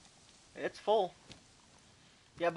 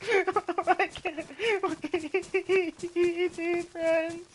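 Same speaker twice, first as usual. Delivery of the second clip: laughing; 9 semitones higher, 4 dB quieter, much slower than usual. Real, not acted. Laughing through song.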